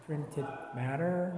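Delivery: ordinary delivery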